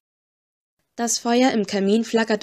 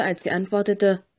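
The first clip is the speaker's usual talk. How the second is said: usual speaking voice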